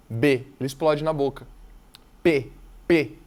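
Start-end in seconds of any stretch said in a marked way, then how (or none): 2.2-3.1 P, P popped hard and loud; exploding P. Shown as a demonstration. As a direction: burst the P from the lips. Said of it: "P, ele explode na boca". (P explosivo, forte)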